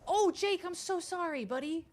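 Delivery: high, loud cry of apology. Real, not acted. Player apologizing to Jake, exclaiming loudly. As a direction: burst out apologizing, pitch easing down.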